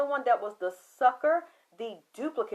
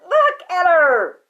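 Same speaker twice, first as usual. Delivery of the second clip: loud command; 6 semitones higher, 14 dB louder, slower than usual. Retold or imitated. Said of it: "He said".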